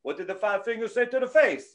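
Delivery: lightly mocked Italian accent; a hint of hammed-up Italian. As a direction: slip into a comic Italian accent. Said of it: "why it was an Italian accent".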